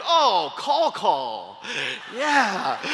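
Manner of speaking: drawn-out mock "oh, I get it"; mock dawning realization. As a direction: play clueless kids slowly catching on. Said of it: "And they're like".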